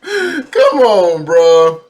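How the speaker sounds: loud, high laugh-through protest; laughing disbelief. Real, not acted. Laughing reaction to dispatch call.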